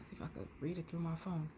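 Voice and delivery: quick breathy whisper. Real, not acted. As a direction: mutter it fast under your breath. Whispering aside while reading.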